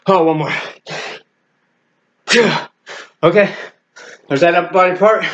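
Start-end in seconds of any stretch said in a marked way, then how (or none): 2.3-2.7 higher, falling burst of effort; short effort yell; straining exertion cry. Real, not acted. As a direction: force out one final rep. Last hard push-up.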